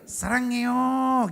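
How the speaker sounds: slow "Sarangyo" with long gliding vowels; sweetly drawn out. Shown as a demonstration. Comic showing the proper Korean way, slow and sweet. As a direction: stretch "Sarangyo" out lovingly. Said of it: "Memang gitu".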